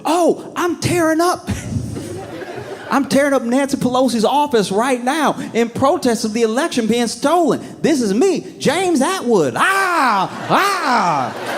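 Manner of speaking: high, gleeful rioter voice; a high, giddy mock rioter on camera. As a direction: brag it proudly, high and laughing. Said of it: "It's like".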